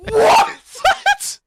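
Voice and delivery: high squeal of disbelief, laughing; stunned what. Real, not acted. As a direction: shriek it, half laughing.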